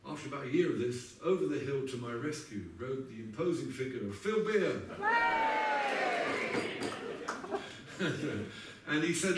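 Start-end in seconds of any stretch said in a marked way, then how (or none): 4.9-8.8 a big laugh that leaps up high; high hearty laugh. Real, not acted. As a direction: burst out laughing at the memory. Laughs at [name]'s arrival.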